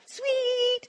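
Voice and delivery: high, long-held imitated child's call; kids calling out. Teacher imitating kids on the bus, calling out high. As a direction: sing it out up high. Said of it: "I'd hear another".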